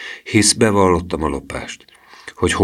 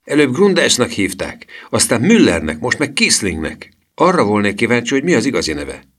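put-on high-pitched, mildly indignant boy; Gustav's high, excited protest, easing at the end; mild exclamation; 6 semitones higher than usual. A narrator's reading. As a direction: exclaim it high, with rising impatience. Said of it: "kiáltotta Gustav".

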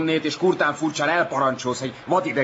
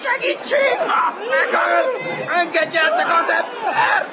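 very high desperate screaming; panicked cry for help; 13 semitones higher, 3 dB louder, slower than usual. Acted. Beaten rival crying for help, panicked screams. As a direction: scream for rescue, far above your usual pitch.

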